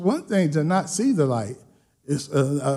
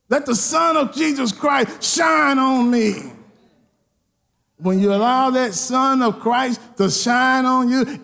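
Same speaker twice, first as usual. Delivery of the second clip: full-force preaching; 8 semitones higher than usual. Acted. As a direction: preach it to the rafters, building.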